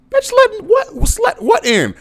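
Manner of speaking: leaping way up into a loud high disbelieving squeal; incredulous squeal. Pretend. Grown son mocking his mama's warning, a high incredulous squeal. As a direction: shriek it like you can't believe your ears.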